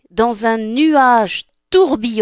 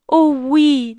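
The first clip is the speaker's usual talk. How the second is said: Cinderella voice; 7 semitones higher, 4 dB louder than usual. Acted. Cinderella eager.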